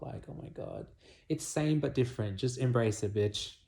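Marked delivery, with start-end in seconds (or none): 0.0-0.9 breathy whisper, barely voiced; whispered aside. Real, not acted. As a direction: drop to a whisper as if overwhelmed. Overwhelmed aside after stressing point.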